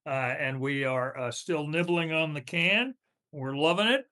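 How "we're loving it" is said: normal talking voice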